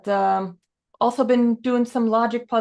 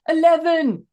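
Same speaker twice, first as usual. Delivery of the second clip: put-on higher, louder shout in a Scottish accent; 9 semitones higher, 6 dB louder, slower than usual. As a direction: shout it like a frustrated Scottish guy. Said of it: "they're, like, shouting at it".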